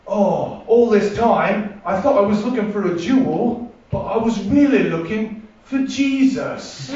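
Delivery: put-on mild Dakota Joe accent on a corny line; cheesy hero line. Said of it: "This was an actual line of dialogue".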